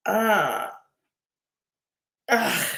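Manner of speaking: hiccupping, soft grunts; hiccups. Real, not acted. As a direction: talk between hiccups. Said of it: "I've got the hiccups".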